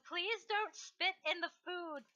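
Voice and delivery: high, slow plea called out; drawn-out, high-pitched mock request; comic pleading. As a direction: call through the bars, half-joking. Prisoner calls through bars, player laughing.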